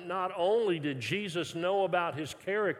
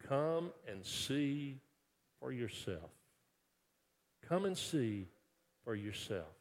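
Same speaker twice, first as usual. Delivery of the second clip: gentle soft invitation; 3 semitones lower, 7 dB quieter than usual. Acted. Pastor's gentle invitation, repeated.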